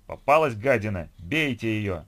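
loud mob war cry; hostile, full-voiced shout; vicious yelling. A narrator's reading. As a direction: incite the crowd to attack. Mob attacks the woman.

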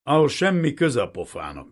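loud, surly dismissal; gruff, hostile retort; grumbled insult. A narrator's reading. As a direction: snap it back rudely.

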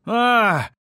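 sudden high scream; high yell. A narrator's reading. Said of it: "заорал всё тот же высокий голос".

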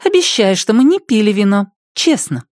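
high teasing jab; a sly, mocking plea; sarcastic. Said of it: "съязвила Миока".

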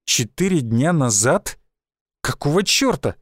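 plain reading voice

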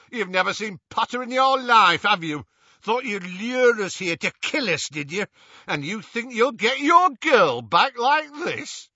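loud, high accusing rant; angry shouting. A narrator's reading. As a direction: rage at a lying captive. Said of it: "shouted the wizard named Selwyn".

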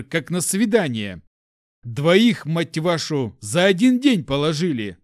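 loud furious tirade; angry rant. Acted. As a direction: rage at the men. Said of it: "Глаза его налились кровью".

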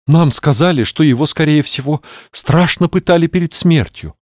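high breathy sultry lady. Acted. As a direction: say it on the breath, heaving. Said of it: "с придыханием произнесла она". (с придыханием)

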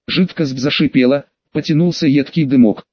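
usual speaking voice

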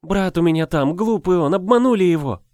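high, pleading through tears; tearful pleading. Acted. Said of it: "всхлипывает и заливается слезами".

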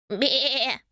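wavering, drawn-out baa; trembling imitation of a sheep; sheep's bleat. A narrator's reading. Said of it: "заблеяла она".

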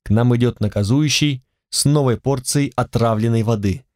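usual speaking voice

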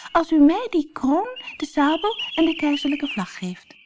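high, light little bird voice; nightingale's voice. Acted. Nightingale bargains with Death in a high voice. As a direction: sing out as a small bird. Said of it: "zei de nachtegaal".

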